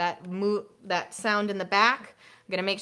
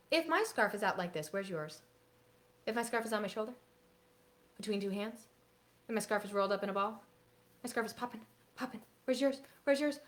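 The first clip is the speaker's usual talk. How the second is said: teacher call-and-response; 2 semitones higher, 9 dB quieter than usual. Shown as a demonstration. Leads kids' scarf routine.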